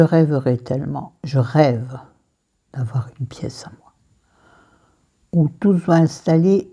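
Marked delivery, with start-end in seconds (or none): 2.7-3.9 breathy whispered wish; whispering. Real, not acted. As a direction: whisper it softly to yourself. (chuchoté)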